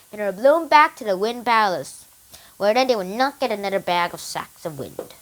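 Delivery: usual speaking voice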